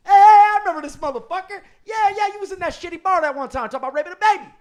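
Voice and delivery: high, loud, rowdy jailhouse shouting; loud inmate yelling. Retold or imitated. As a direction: yell it across the cell block. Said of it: "motherfuckers recognized me".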